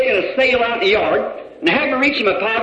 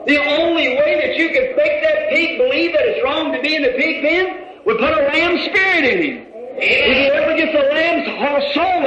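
pitched-up revival preacher's cry; impassioned preaching; 4 semitones higher than usual. Real, not acted. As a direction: drive it home to a congregation answering amen. Preaches with rising force to congregation.

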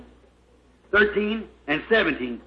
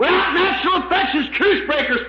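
quick, high pulpit denunciation; impassioned preacher's list; fervent preaching; 6 semitones higher than usual. Real, not acted. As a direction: rattle off the sins high and fast. Preacher fervently lists sins to congregation.

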